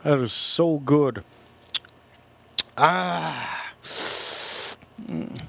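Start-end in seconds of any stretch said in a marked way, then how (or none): 2.8-5.4 sounds while licking fingers. Real, not acted. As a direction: mm through licked fingers. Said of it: "I'm gonna lick my fingers".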